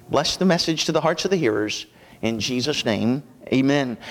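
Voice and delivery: low, hushed opening prayer. Acted. Pastor praying over the congregation before the message, hushed and reverent. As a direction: pray reverently, pitched low and softened.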